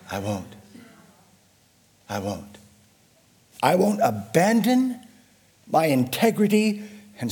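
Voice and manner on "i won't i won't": quiet resolve. Acted. Quiet resolve after build.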